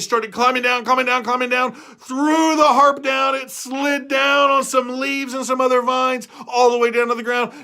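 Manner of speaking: high, loud breathless storytelling; excited chase. A narrator's reading. Narrator telling Jack's escape down the beanstalk, excited. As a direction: race through it like the chase itself.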